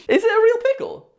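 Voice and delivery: shocked high squeal; high disbelieving question; surprised. Real, not acted. Shocked at real pickle.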